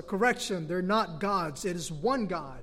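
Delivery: ordinary talk, nothing marked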